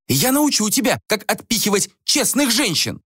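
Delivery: high-pitched, outraged yell; acted fury of a scorned woman; furious threat. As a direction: threaten him while hurling things. Enraged woman throwing shoes at him.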